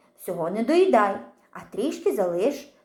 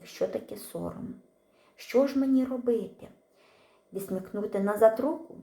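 usual speaking voice

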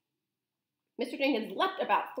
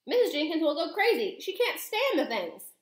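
high, raised, agitated voice; panicky protest; 8 semitones higher, 4 dB louder than usual. A narrator's reading. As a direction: wail in dismay at the thought. Father panics about wife's reaction.

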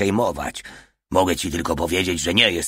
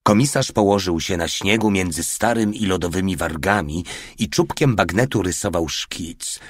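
ordinary delivery, nothing marked

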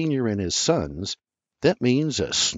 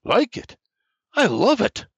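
much higher, louder outburst; roaring delight; 12 semitones higher, 4 dB louder than usual. A narrator's reading. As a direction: burst out with joy. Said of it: "James Junior roars".

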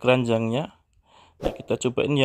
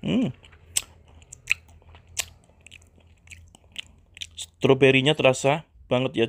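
talking while chewing; speaking right after a bite; mouth partly full. Real, not acted. Seen 2: Bit the pink cake at -5 to -1, then talks while holding it.

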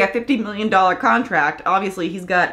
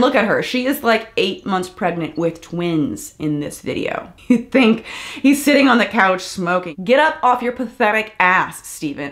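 ordinary talk

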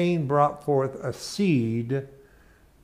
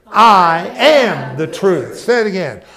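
slow, loud, ringing declaration; loud proclamation; 6 semitones higher, 12 dB louder, slower than usual. Shown as a demonstration. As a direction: call it out for the room.

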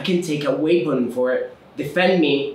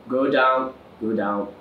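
ordinary talk

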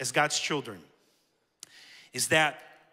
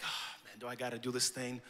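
much softer whispered grumble; reluctant whisper; 7 semitones lower, 14 dB quieter than usual. Retold or imitated. Stan dreading having to pray, whispered grumble.

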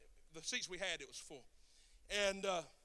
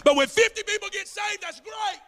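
higher, louder shout on fifty; preaching shout; 13 semitones higher, 15 dB louder than usual. Real, not acted. Preacher exclaiming to the church about fifty saved, shouting. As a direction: shout it out to the congregation.

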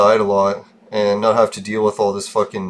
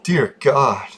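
ordinary talk

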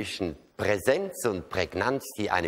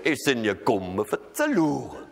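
Rhenish landlord. Shown as a demonstration. Landlord at the door asking in thick Rhenish dialect. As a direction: imitate the landlord's Rhenish, unhurried. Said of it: "Was hat das für eine Rhythmik?".

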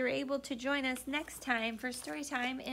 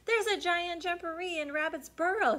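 scared elephant; 8 semitones higher, 4 dB louder than usual. Acted.

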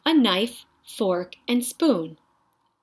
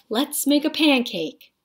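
put-on high, excited little boy; eager boy; 6 semitones higher, 3 dB louder than usual. A narrator's reading. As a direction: beg for the pancake happily.

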